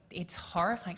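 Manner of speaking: ordinary talk, nothing marked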